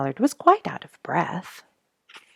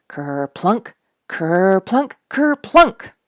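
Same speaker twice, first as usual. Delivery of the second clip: each ker-plunk louder and higher; building splashes; 4 semitones higher, 8 dB louder, much slower than usual. A narrator's reading. Reading to children, splashes building to loud high. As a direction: climb to a big splashy finish.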